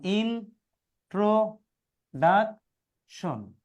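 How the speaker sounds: Introduction slowly as four separate beats; introduction read out chunk by chunk; broken into syllables. Shown as a demonstration. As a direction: pause after each syllable of introduction: in, tro, duc, tion. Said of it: "ভেঙে ভেঙে পড়বা".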